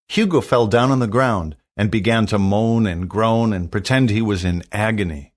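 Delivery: ordinary delivery, nothing marked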